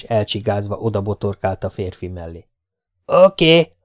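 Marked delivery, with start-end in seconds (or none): 3.1-3.8 louder, higher sudden call; ringing call. A narrator's reading. A hidden man answers from the bush, ringing shout. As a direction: shout it from the bushes. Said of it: "harsant fel ekkor egy hang".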